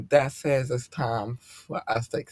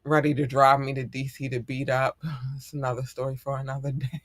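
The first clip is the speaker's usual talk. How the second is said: usual speaking voice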